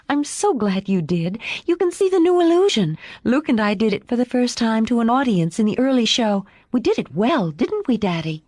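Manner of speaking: put-on high, eager child's voice; delighted little girl. A narrator's reading. Daughter telling Madame about the new illusion, delighted.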